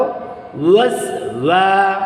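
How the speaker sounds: ওয়াসওয়া with lips rounded on ওয়া, slow. Shown as a demonstration. Teacher shows the lips to the child, slowly. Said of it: "আমার ঠোঁটের দিকে তাকাও".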